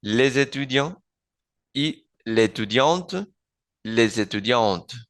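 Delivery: les étudiants with a Z linking into étudiants; les-Z-étudiants, linked slowly; liaison demo. Shown as a demonstration. Teacher showing liaison and elision.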